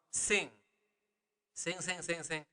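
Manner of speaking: soft, rattled-off string of noes; quick little demo of declining; repeated refusal. Shown as a demonstration. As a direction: toss off the repeats lightly and quietly. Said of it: "So I'm just saying".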